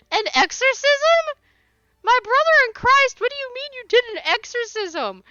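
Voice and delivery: high, loud shriek of disbelief; "exorcism" cried out, stretched and shrill with shock; incredulous shriek. Real, not acted. Streamer shrieking at chat in real disbelief.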